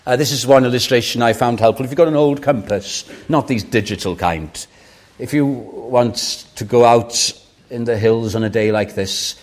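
ordinary delivery

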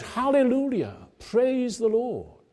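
very high, ringing Hallelujah, slowing on praise the Lord; shouted praise. Retold or imitated. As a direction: cry it out again, overjoyed. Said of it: "again the African student shouted out".

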